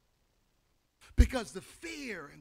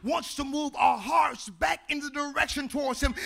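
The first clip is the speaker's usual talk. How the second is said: full-force preaching; 3 semitones higher, 12 dB louder than usual. Acted. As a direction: build the call up to the congregation.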